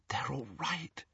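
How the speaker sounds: whispered. A narrator's reading. Hermione reassuring Ron about his family, whispered. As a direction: whisper the relief. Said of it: "she whispered".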